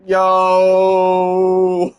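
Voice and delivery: loud, long drawn-out cheer; thrilled outburst. Real, not acted. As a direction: let it ring out, thrilled. Player thrilled by ending.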